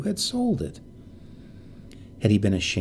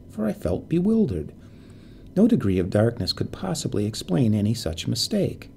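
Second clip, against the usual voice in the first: ordinary delivery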